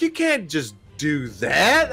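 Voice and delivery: high, slow mock outrage; mock shocked friend. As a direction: play her stunned disbelief, drawn out. Arilys's shocked reaction, retold.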